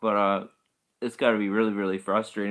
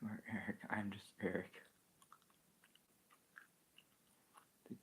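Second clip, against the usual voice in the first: soft whispered aside; playful hushed whisper to the chat; 18 dB quieter, faster than usual. Pretend. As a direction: whisper it as a secret joke.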